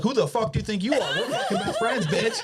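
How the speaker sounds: high, laughing fake threat; laughing mock tough-guy. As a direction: play the tough line while cracking up. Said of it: "We're like".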